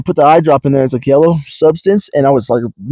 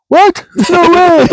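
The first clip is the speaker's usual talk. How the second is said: very high, loud disbelief; can't believe it's already over; shocked disbelief; 4 dB louder, slower than usual. Retold or imitated. Telling a friend about the eye doctor, high shocked disbelief. Doctor says they're done.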